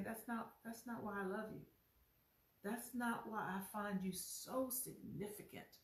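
soft, quiet voice of God; tender reassurance. Acted. Preacher voicing God to a self-doubting church: soft tender reassurance. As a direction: hush it down, gently reassuring. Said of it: "God is saying".

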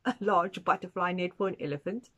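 usual speaking voice

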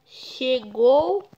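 ordinary delivery

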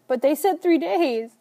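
high, loud tearful cry; sobbing protest. A narrator's reading. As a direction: cry it out in disbelief. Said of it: "His words make me sob harder".

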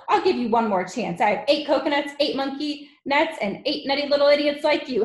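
loud, high, exasperated tirade; bullying headmistress shout; Trunchbull. A narrator's reading. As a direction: berate a pupil with relish for each insult. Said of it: "shouted the Trunchbull".

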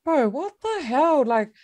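high-pitched, drawn-out disbelief; shocked. Retold or imitated. Runner stunned by coach's promise, shocked high voice. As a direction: react in stunned protest, pitch way up. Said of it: "I was shocked".

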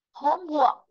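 "Homework" with HOME high, falling on "work"; teacher's model "Homework", first part stressed. Shown as a demonstration. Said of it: "nó sẽ rơi vào chữ home".

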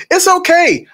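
high, singsong reassurance; put-on high cheerful voice; oblivious creep. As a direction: chirp it blithely.

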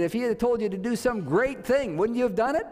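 high-pitched, rising rhetorical question; acted pleading to see reason, lifted at the end; urging appeal. Servants reason with Naaman about the great thing, urging.

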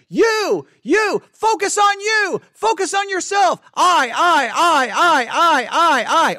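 high, loud selfish shout; screaming inner voice. Acted. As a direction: scream it like the ego demanding attention. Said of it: "internal voice screaming".

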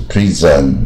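"prison" wrongly as "pree-zan"; "prison" with an unreduced last syllable; full second vowel. Shown as a demonstration. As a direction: sound out both vowels of "prison" fully. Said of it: "如果有呃的音的话".

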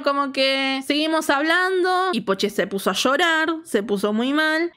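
ordinary talk, nothing marked

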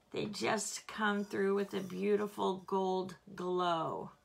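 ordinary talk, nothing marked

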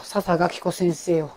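breathy playful whisper; hushed teasing aside. Real, not acted. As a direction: whisper it like a little secret. Whispered aside while cooking.

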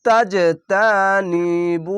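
very slow, drawn-out Quranic recitation of তাজতানিবু. Shown as a demonstration. Teacher showing students slow reading with qalqala. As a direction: stretch every syllable of তাজতানিবু. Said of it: "এখানে একটু slow করে কেন পড়লাম".

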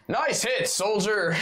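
high, excited battle yell; in-character yell. Acted. Fighter yells to a comrade while leaping midair, yelling. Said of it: "Midair, I yell".

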